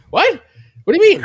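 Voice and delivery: high, loud startled squeak imitated; shocked vegan. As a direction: imitate her shocked high-pitched question. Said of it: "She was like".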